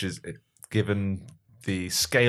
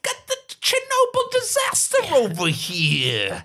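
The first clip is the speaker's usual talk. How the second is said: much higher, silly panicked guy; squeaky cartoon man panicking; high comic character voice; slower than usual.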